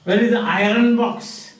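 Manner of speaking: "iron" in the question said eye-run; rhotic R. Retold or imitated. Teacher imitating Germans asking a question, rhotic R. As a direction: sound the R in "iron box". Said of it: "Germans pronounce R everywhere".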